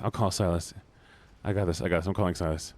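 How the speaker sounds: ordinary talk, nothing marked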